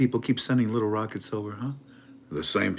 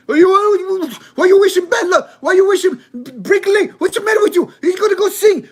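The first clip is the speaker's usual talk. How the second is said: very high, loud, squeaky outraged voice; confused Iraqi onlooker; 13 dB louder than usual. Acted. An Iraqi onlooker squeals at the well-wisher, outraged. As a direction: squeal it out like a baffled foreigner. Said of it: "somebody from Iraq would be sitting".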